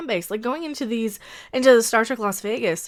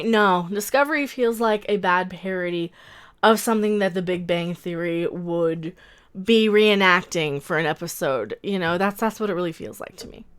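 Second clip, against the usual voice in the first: ordinary talk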